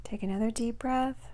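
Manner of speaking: soft, unhurried meditation voice with long pauses; guided meditation. Acted. Coach guiding listeners to release depression, soft guided-meditation voice.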